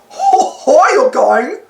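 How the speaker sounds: dopey drawn-out cartoon greeting; high goofy hello to the gang. Goofy greets Mickey and the boys: dopey cartoon hello. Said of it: "said Goofy".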